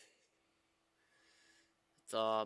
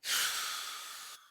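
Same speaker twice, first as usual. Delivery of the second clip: weary sigh; 4 dB louder than usual. Real, not acted. Frustrated coder stuck on bug.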